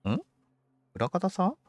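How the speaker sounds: ordinary delivery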